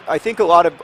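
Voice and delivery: usual speaking voice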